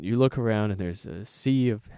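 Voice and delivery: ordinary talk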